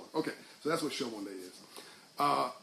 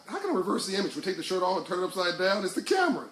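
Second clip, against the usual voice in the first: higher, louder comic protest; mock exasperation; 4 semitones higher, 5 dB louder than usual. Real, not acted. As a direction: rise into incredulous exclamation at a silly request. Mock protest at a viewer's request.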